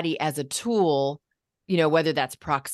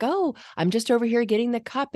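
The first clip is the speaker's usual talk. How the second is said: nervous narrating; 7 semitones higher than usual. Retold or imitated. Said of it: "that nervous".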